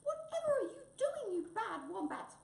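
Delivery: very high, loud squeaky wail; indignant high-pitched mouse cry. Acted. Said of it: "wailed Mouse behind the scenes".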